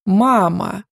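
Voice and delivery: hollow voice from beyond the grave; sepulchral voice. A narrator's reading. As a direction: deliver it like a ghostly curse. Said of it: "загробным голосом закончила". (загробным голосом)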